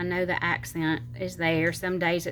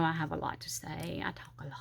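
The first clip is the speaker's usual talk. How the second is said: whispered aside; 9 dB quieter, faster than usual. Real, not acted. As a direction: drop to a near-whisper. Confessional aside about being talkative.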